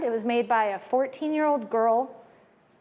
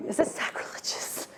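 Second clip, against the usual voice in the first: whisper; 6 dB quieter than usual. Real, not acted. Whispered aside.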